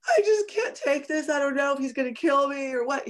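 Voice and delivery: high, distressed plea; retold crying. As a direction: replay breaking down in front of the cop. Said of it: "I started crying and I said".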